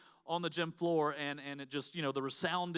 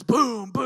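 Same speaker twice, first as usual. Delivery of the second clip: loud booming "boom"; big sound-effect boom; imitated booming crashes; 6 semitones higher, 13 dB louder than usual. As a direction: punch out the thud of dropping plates.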